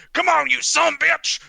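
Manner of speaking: high, very loud wrestler's taunt; goading shout. Acted. Wrestler taunts the gator, shouting. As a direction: yell it at the beast to provoke it.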